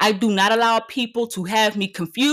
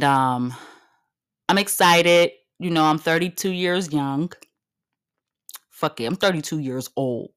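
ordinary delivery

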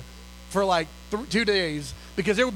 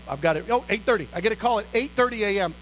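ordinary delivery, nothing marked

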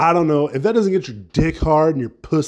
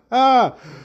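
bright cackle after the punchline; real laugh; 11 semitones higher, 4 dB louder than usual. Real, not acted. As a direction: burst of high laughter at his own joke. Laughs at own sex joke.

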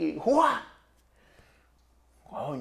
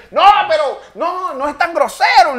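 retold protest; 11 semitones higher, 6 dB louder than usual. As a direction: plead your case to the jury.

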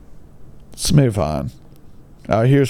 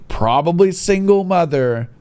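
ordinary delivery

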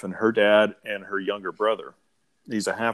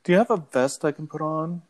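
lightly imitated movie-line delivery, pitched up; a light character impression, voice lifted a little; Kip; 5 semitones higher than usual. Quoting the film for fun, lightly voiced impression. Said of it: "I'm just having fun doing Kip lines".

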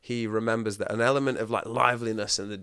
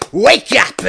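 loud, much higher rousing shout; Robert's shout; 13 semitones higher, 18 dB louder than usual. Retold or imitated. Robert echoing an affirmation, shouting it loud. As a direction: roar it to rouse the room. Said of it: "And he'd go".

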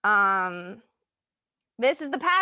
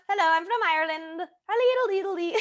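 high, silly mock Irish lilt; terrible Irish accent; 8 semitones higher, faster than usual. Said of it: "That one was terrible".

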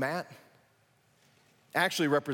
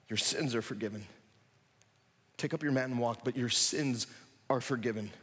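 tender; 6 semitones lower, 5 dB quieter than usual. Retold or imitated. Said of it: "Jesus repeats his words".

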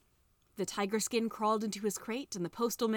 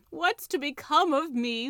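slow, high, louder wail; 6 semitones higher, 6 dB louder than usual. A narrator's reading. Tiger skin begging the children for help, slow wail. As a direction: wail the question out, pleading. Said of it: "wailed the tiger skin".

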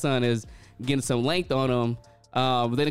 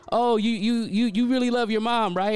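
lightly voiced higher-pitched salon worker; salon worker's question; 8 semitones higher than usual. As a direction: quote her curious question, pitched up.

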